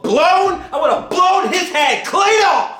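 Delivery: building to a high, loud yell; hammed-up furious shout. Reviewer imagining how he'd finish off Wallace, hammed-up yelling. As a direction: shout the threat in mock outrage. Climax of his imagined revenge.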